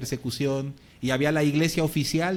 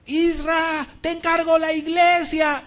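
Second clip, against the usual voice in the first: much higher, drawn-out called shout; shout across cells; 13 semitones higher, 6 dB louder, slower than usual. Retold or imitated. Arrested pastor shouts to Isra through the cells, slow high call. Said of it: "él le gritó por las celdas".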